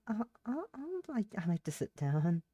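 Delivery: small, high, soft, hesitant voice; timid stammer. Acted. Shocked girl asking strangers if she can sit down, timid stammer.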